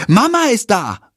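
put-on high-pitched child's cry; calling out. A narrator's reading. Tom announcing his mother's arrival, calling out. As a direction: call it out like a small boy. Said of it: "ruft Tom".

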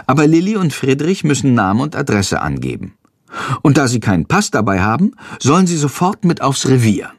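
usual speaking voice

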